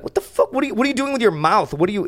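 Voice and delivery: high-pitched, incredulous questioning; baffled boss. Retold or imitated. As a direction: imitate a boss reacting in disbelief. Old boss's startled reaction, acted.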